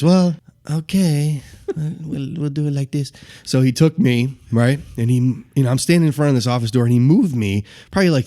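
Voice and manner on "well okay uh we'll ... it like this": higher, unhurried, passive voice; put-on mild, hesitant voice; Joe Haas. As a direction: imitate a meek man calmly agreeing. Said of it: "very quiet and passive in the way he talks".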